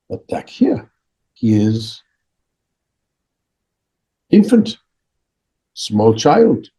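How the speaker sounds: put-on deliberate accented teacher with long pauses; Dalai Lama. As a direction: lay out each stage separately, pitch often lifting. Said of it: "He said".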